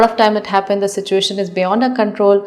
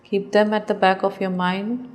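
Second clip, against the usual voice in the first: slow, softer meditation voice with long pauses; guided meditation; 6 dB quieter than usual. Acted. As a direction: ease the listener into calm, one body part at a time.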